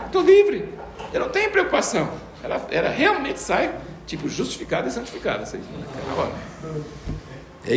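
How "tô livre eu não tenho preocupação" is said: put-on carefree child.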